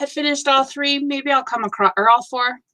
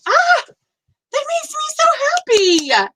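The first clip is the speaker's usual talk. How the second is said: high, slow, gushing delight, much higher; overjoyed; 4 dB louder, slower than usual. Real, not acted. Praising a viewer's photo turned cross stitch, slow high gush. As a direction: gush it out like it's the best news.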